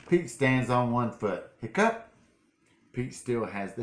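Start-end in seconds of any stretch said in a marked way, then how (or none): 1.6-2.1 mock hiccup. Story hiccup sound.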